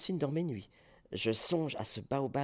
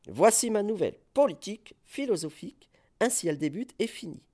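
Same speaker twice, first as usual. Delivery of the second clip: grand proclamation; 8 semitones higher, 5 dB louder, faster than usual. Acted. Proclaiming new philosophy.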